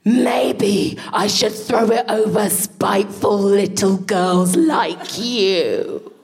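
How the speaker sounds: slow, drawn-out spiteful scolding; hammed-up slow telling-off; retold teacher's shouting. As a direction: act out a teacher scolding a child, every word stretched. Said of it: "This guy who shouted at children".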